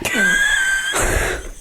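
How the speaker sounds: high, wild burst of laughter; cracking up at the joke; a squealing cackle of delight; shrieking laugh. Real, not acted.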